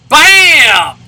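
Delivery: high, loud triumphant bam; excited shout. Real, not acted. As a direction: yell it at the big hit. Big hit in game.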